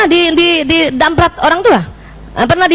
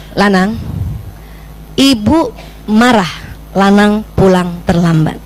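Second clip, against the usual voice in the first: slow, measured scolding; calm firm reprimand; 5 semitones lower, slower than usual. Shown as a demonstration. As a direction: state it slowly and steadily to the child. (teguran pelan dan tegas)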